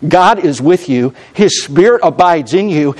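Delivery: ordinary talk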